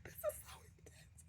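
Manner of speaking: overwhelmed whisper; whispered self-talk in the moment. Retold or imitated. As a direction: hush it out, overcome. Retold emotional breakdown, self-quote.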